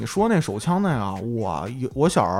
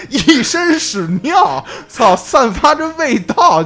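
laughing; 8 semitones higher, slower than usual. Real, not acted. Telling while laughing, drawn out.

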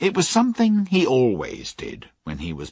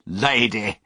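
unpleasantly. A narrator's reading. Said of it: "said one of the men unpleasantly".

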